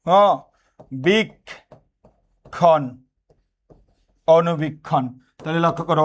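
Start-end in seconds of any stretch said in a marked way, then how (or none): 1.0-2.9 বীক্ষণ drawn out very slowly; syllable demo. Shown as a demonstration. Teacher breaks বীক্ষণ apart slowly for the class. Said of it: "এরকম যদি বলি".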